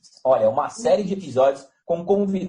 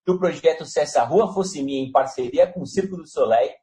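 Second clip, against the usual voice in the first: ordinary delivery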